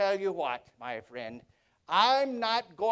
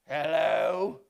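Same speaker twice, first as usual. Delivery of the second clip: a lower, put-on gruff growl; mock growl; 7 semitones lower than usual. Scrooge faking his old growl at Bob. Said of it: "I growled in my accustomed voice".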